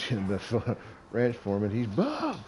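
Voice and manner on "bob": a foreman's startled yell across the shop; alarmed call. Retold or imitated. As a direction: shout his name in alarm.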